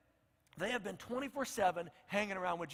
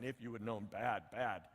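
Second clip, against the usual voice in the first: mock scolding; 11 semitones lower, 5 dB quieter than usual.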